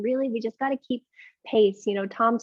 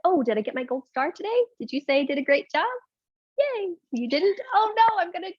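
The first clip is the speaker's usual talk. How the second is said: mock needy self; 8 semitones higher than usual. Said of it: "It's like".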